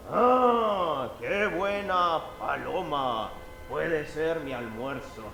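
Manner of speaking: slow, relishing hunter voice; a hint of a greedy hunter. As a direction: savour the thought of lunch. Hunter eyes dove as lunch.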